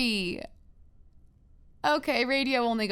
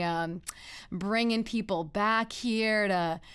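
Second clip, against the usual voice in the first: usual speaking voice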